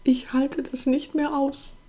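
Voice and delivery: high, slow, holding back tears; suppressed tears. A narrator's reading. Sister confessing to her brother, suppressed tears. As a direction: plead slowly, close to crying. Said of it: "nach unterdrückten Tränen".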